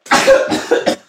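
explosive real sneeze; sharp, high burst of a sneeze; sudden sneeze. Real, not acted. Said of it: "I'm gonna sneeze".

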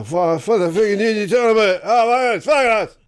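put-on loud, high slurred drunken rant; drunk Scot ranting. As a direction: shout it like a staggering drunk Scot. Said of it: "he's staggering all over the road".

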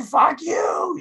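high mock shout; shouting rock star. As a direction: yell it out from the stage. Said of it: "is like".

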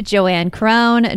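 usual speaking voice

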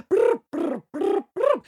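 loud high trilled brr; horse noise. Acted. Storyteller sends Jack off on his horse, high trilled brrs.

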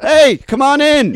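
loud, high call of welcome; hearty welcome. Retold or imitated. Everyone at the party greeting a stranger, hearty welcome. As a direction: call out like a crowd welcoming her in.

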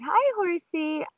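high, slow animal hello; retold singsong greeting; cooing horse greeting. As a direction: draw it out high and sweet. Greeting horse while passing it.

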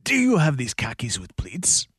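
strained, pushed attempt at a Spanish accent; strained Banderas accent. For the hosts, a strained impression that sounds constipated. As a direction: squeeze out a clumsy Banderas accent. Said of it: "sounds like you're constipated".

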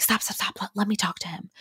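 unvoiced whisper, hurried; quick breathy plea to stop; urgent whisper. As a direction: whisper to the driver to pull over. Urging the driver to stop.

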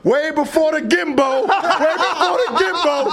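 talking through laughter; laughing. Real, not acted. Laughing while retelling the story.

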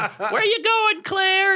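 very high, drawn-out squawk; hammed-up high-pitched nagging call; mock calling out. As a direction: imitate friends calling after someone. Retold friends calling after bride.

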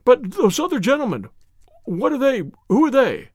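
high, suspicious queries; nervous man demanding reassurance; anxious questioning. A narrator's reading. Frightened man questions the visitors.

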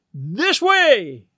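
high, drawn-out rallying call; rallying cry. A narrator's reading. Old lady leading the women onward, crying out. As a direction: call the crowd after you. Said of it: "cried Missus Bellows".

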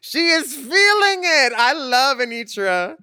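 high, slow excited cheer; excited shout. Real, not acted. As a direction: cheer her on at full volume.

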